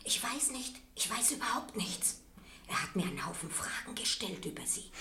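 soft, secretive whisper; landlady quietly telling the student about the police questions; hushed whisper. Acted. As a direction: keep it down, nervous.

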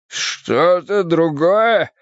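drawn-out, pained astonishment; incredulous echo. Acted. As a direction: throw the words back in disbelief. Poisoned man echoes in disbelief.

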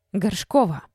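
loud, ringing reprimand; tense, ringing summons. A narrator's reading. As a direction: call the surname out in indignation. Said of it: "Зоин голос и зазвенел".

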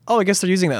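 mock surprise. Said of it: "where I'm like".

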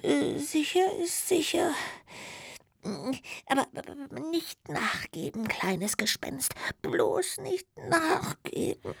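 sleepy whisper begging itself; fighting sleep. Acted. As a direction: keep yourself awake, half asleep. Sleepy ghost urges itself on.

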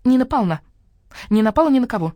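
proud. Acted. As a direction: answer with hen pride. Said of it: "гордо отозвалась". (гордо, громче)

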